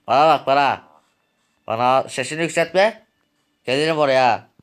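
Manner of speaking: angry shout at the younger man. Acted. Heated quarrel between characters.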